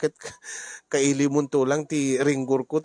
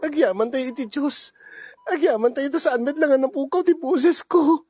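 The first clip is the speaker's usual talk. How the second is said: rising, louder joyful thanks to God; 10 semitones higher, 4 dB louder than usual. Acted. Mother thanking God aloud after surgery, overjoyed. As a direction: pour out overjoyed gratitude to the Lord.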